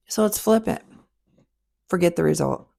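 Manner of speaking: ordinary delivery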